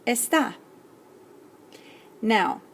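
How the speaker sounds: ordinary talk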